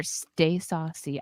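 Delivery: "Stay saucy" slow and stretched; drawn-out catchphrase. Shown as a demonstration. Streamer greeting a member with their catchphrase, drawn out. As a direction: draw the words right out. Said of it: "I have to say it like that every time".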